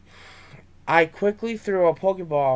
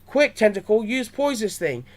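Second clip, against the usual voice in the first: yelled; 6 semitones higher than usual. A narrator's reading. Boy ordering Tentacool to protect Charmander, yelled. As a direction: shout the order in concern. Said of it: "I yelled".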